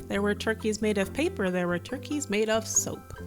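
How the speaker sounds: usual speaking voice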